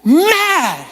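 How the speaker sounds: high, drawn-out outburst of anger; mad. Retold or imitated. Preacher telling how mad he was at the whisperer, high and exasperated. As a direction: stretch it out, high and loud. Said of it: "I was so mad".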